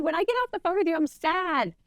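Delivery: high-pitched, hurt re-enactment; plaintive appeal. Retold or imitated. Agent explains to client how calls leave her, plaintive.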